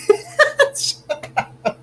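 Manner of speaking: squealing, breathless laughter; high helpless laugh. Real, not acted. Streamer cracks up at a chat joke, high squealing laugh. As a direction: lose it completely at the joke.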